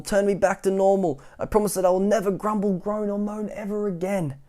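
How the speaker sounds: a hammed-up little pig begging. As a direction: plead to be turned back. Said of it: "begged Mister Grumble".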